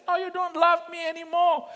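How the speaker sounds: mock tearful wife. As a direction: ham up the wife's complaint. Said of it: "He says".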